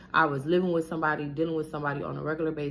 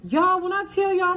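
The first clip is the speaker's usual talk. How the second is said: much higher and louder, outraged; outraged exclamation; 9 dB louder than usual. Real, not acted. Telling viewers about his insult, outraged and loud. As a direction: burst out in disbelief.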